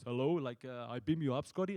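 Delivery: lightly imitating a stately starship captain; mild Star Trek captain impression; Patrick Stewart voice. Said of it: "he has his voice".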